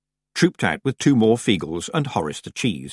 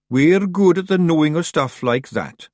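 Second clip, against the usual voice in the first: high, loud Scots Feegle voice; Daft Woolly; 8 semitones higher, 5 dB louder than usual. A narrator's reading. Feegle boasting to Tiffany of his knowledge, high loud Scots. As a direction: boast proudly as a little Scottish creature.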